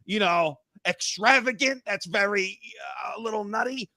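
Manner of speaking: ordinary delivery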